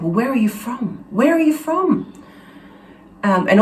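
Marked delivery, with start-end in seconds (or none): 0.0-2.1 impatient questioning. Retold or imitated. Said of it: "impatient to know everything".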